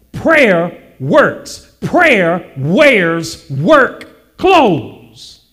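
each word set apart and punched; measured preacher's emphasis on every word; deliberate slogan. Real, not acted. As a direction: drive it home slowly, word by word. Preacher restates his slow slogan.

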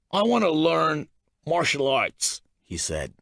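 unstable man. A narrator's reading. Narrator voices unstable Mystery.

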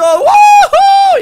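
high loud whoop; cheering. Real, not acted. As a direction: cheer in triumph. Shovel survived.

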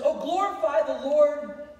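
loud, high, insistent proclamation; rousing preaching. Acted. David calling the broken and hurting to hear, preached at full force. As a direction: build a drumbeat of ringing appeals.

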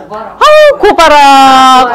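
slow, drawn-out Gyeongsang praise; Gyeongsang grandma. Retold or imitated. Teacher imitating Gyeongsang grandmothers praising. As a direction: coo 아우 고바라 like a Gyeongsang granny. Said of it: "아이고 고바라 이래요".

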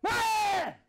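sudden piercing high shriek; loud, high, short frog scream; imitated frog scream. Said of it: "It'll jump and scream".

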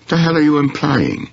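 high, sharp indignant outburst. A narrator's reading. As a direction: bristle at the insinuation. Accused man bristles at Henry.

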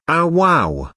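mild mock shriek, pitch jumping high; high, half-hearted shriek. A narrator's reading. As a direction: wail it in comic dismay, without shouting. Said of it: "shrieked the plump girl".

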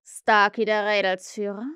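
emphatic question with rising end; menacingly friendly. A narrator's reading. Captain names the ringleader, ominously friendly. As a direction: pose it with false kindness. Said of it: "freundlicher als je zuvor".

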